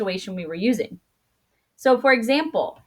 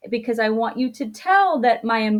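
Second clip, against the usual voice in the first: normal talking voice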